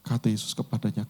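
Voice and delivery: normal talking voice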